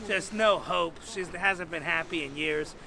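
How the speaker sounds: usual speaking voice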